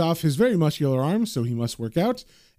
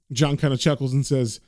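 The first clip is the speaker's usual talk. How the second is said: plain reading voice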